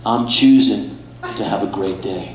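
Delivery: slow, airy zen voice, lightly done; drawn-out serene yoga instructor impression; mock yoga guy. Comic imitates yoga guy telling barista about his day, slow and serene. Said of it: "This dude looks at me and says".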